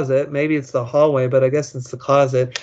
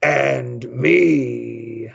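slow, drawn-out big ghost voice, lower than usual; 3 semitones lower than usual. Acted. Ghost answers the girl alone in her room, in a big drawn-out voice. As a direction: stretch both words out like a looming ghost. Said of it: "Then a big voice said".

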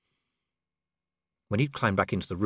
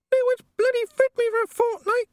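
high, louder squeaky imp voice; tiny homunculus voice; 6 dB louder than usual. A narrator's reading. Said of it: "the imp squeaked".

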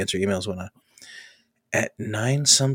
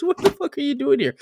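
much higher mock astonishment; stunned disbelief. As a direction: repeat the boss's shocked question, imitating. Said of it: "Literally his words".

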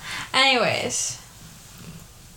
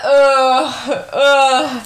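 disgusted groan; 6 dB louder than usual. Retold or imitated. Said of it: "I'm like".

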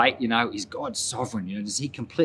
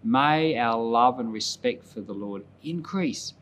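soft, reverent prayer voice, fading quieter; closing prayer; 4 dB quieter than usual. Real, not acted. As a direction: pray softly to close the service. Pastor closes with prayer.

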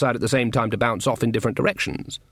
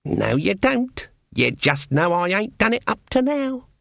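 slow, higher, knowing; dry retort; 7 semitones higher than usual. Acted. Granny corrects Nanny, slow and dry. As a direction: say it with dry menace.

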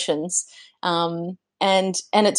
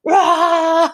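short high roar with a laugh; mock primal scream; 10 semitones higher, 4 dB louder than usual. Driving off alone in the car, a laughing mock scream. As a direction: let out a playful primal yell. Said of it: "I'll drive off, like".

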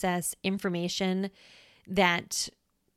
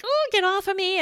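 high, louder panicked cry; mock panic; 12 semitones higher, 5 dB louder than usual. Hiker swatting at a snake, mock panicked cry. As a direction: flail and shout it off you.

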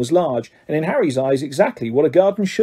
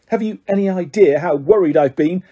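ordinary delivery

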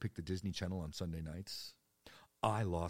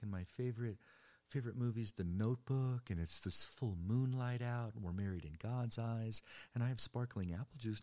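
mock smooth talker; 5 semitones higher than usual. Mock romantic teen boy line.

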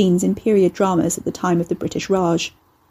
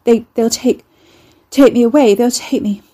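terrified stammer; 3 semitones higher, 6 dB louder than usual. A narrator's reading. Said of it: "a new spike of terror".

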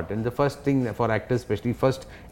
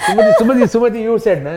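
calling out; 11 semitones higher, 8 dB louder, faster than usual. Retold or imitated. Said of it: "father calling out to mom".

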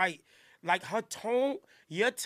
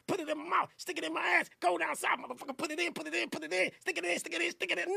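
mocking aggressive rapper; 7 semitones higher, 4 dB quieter, faster than usual. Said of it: "drill female rappers rap".

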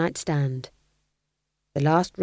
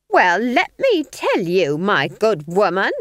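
shrieking; 3 semitones higher, 6 dB louder, slower than usual. A narrator's reading. Nanny rounds on Granny at full volume, shrieking. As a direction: spell it out furiously, pitched up. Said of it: "shrieked Nanny".

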